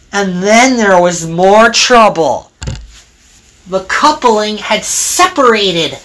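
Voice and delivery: usual speaking voice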